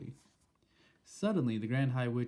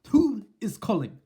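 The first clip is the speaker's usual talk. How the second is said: high, loud, sharp demand; snapped; 10 semitones higher, 10 dB louder than usual. Acted. Witch snapping at the unseen caller, loud and sharp. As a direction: bark the question at the intruder. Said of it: "snapped the Grand High Witch".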